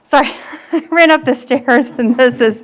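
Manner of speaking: laughing nervously, a bit out of breath; nervous laughter. Real, not acted. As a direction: giggle through the words from nerves. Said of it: "Sorry, I'm nervous".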